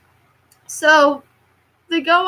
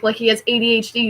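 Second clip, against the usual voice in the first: ordinary talk, nothing marked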